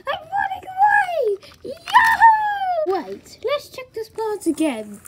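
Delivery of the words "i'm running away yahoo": high-pitched play-acted whoop; gleeful. Toy character celebrating running away, gleeful. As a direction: sing out high in glee.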